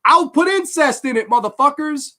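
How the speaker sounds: high, loud defiant rant; mock defiant Franco. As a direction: shout it like a rebel director. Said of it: "So Jess Franco says".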